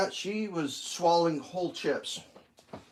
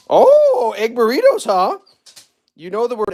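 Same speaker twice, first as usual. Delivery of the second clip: very high, loud, sing-song dog talk; excited pet talk; 8 semitones higher, 12 dB louder than usual. Real, not acted. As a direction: get the dog excited about food.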